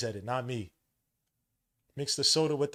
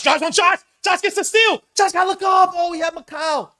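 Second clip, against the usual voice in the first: much higher and louder fast call; excited play call; 11 semitones higher, 12 dB louder than usual. Real, not acted. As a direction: call the play at fever pitch.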